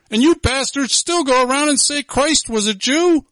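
high, loud, raging sermon; furious shouting. Real, not acted. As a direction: yell it out in outrage. Preacher erupts in furious tirade.

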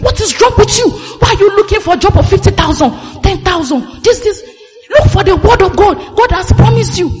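imitating the pastor who wants to flog you; fiery preaching shout. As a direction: bark it at them like a stern pastor. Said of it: "as if he wants to come and flog you".

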